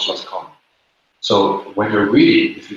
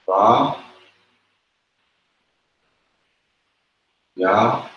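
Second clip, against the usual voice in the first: Ba, ya, yako split with long pauses; syllable by syllable; much slower than usual. Shown as a demonstration. Teacher splits the word into syllables, slowly. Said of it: "divide this up into syllables".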